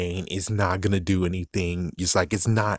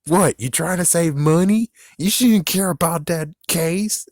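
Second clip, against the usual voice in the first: high, loud incredulous challenge; loud mock outrage; mocking disbelief; 10 semitones higher, 6 dB louder than usual. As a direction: scoff at them, pitch way up. Mocking the villain's careful theft.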